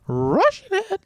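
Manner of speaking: a sudden high crack on a lost voice; real voice break from a sore throat. Real, not acted. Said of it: "I can't do any voices".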